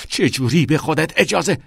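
loud, high indignant burst; outraged challenge. A narrator's reading. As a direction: lunge at him with the words. Lunges at him in quarrel.

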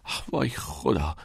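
sighing, wavering worry; waver, torn over the risk; hesitant. A narrator's reading. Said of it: "کریس مردد گفت".

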